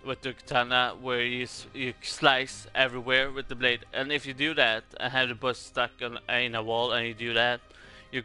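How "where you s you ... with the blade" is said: ordinary talk, nothing marked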